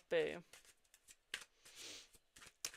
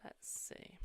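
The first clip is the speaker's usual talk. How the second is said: musing whisper; 13 dB quieter, much slower than usual. Real, not acted. Reading cards, pondering.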